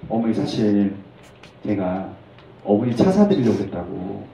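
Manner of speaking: low, soft, halting confession; sheepish explanation. Acted. Brother claiming he meant to buy mother a car, sheepish. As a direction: explain it quietly, with small pauses.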